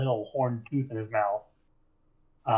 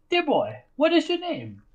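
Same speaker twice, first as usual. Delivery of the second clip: much higher, mock-sweet question; sugary interrogator; 14 semitones higher than usual. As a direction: coax him falsely kindly. Eli questions goblin.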